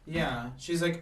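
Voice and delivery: normal talking voice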